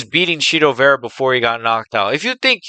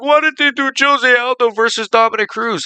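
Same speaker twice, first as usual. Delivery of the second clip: high, whiny mock question; mocking complainers; 10 semitones higher than usual. Mocking everybody who complains about Aldo versus Cruz, high whiny voice. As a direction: whine it like an outraged fan. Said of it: "Everybody's like".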